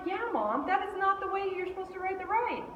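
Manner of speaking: put-on high, quick lecturing kid; insistent daughter. Daughter telling Mom how to ride, insistent. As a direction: correct Mom in a high, fast voice.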